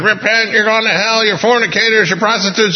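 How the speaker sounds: high-pitched imitation of a screaming child; screaming kid preacher. Eight-year-old on the corner damning passers-by, imitated screaming. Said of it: "standing on the corner screaming".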